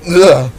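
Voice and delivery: sharp high pained grunt; a jolt of pain pushed out; pained cry. Acted. Spark cries out at the jagged drop below, sharp grunt. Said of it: "The spark cried".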